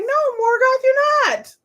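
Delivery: squeaky high no, Morgoth; shutting him down in a mock girl voice; high-pitched snippy brush-off to Morgoth; high sassy refusal.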